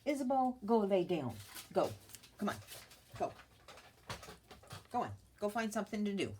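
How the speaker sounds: loud, firm dog command. Real, not acted. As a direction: tell the dog off, loudly. Ordering dog away.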